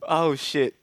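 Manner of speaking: ordinary talk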